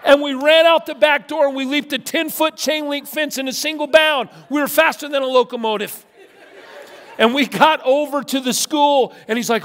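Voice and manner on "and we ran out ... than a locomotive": high, loud, laughing, racing through the escape; breathless glee at a narrow getaway; excited retelling. Retold or imitated. Preacher recalling fleeing the girl's father, laughing and excited.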